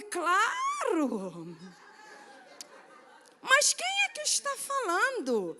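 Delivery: high, drawn-out mock-sweet reply; smitten gushing. Smitten woman answering a stranger on the phone, exaggerated sweetness. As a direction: swoop up high and stretch it coyly.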